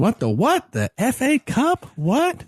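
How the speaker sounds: mock surprise. Mock disbelief at FA Cup.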